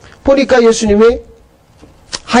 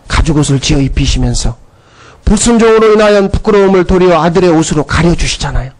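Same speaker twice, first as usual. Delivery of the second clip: ordinary talk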